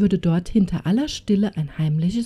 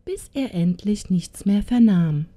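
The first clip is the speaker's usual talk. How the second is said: ordinary delivery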